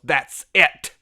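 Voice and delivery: a loud, sudden repeat of the odd line; imitating T J. Retelling T J's strange fight line, loud mock blurt. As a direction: hammer it out abruptly, half laughing. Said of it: "He just stops and goes".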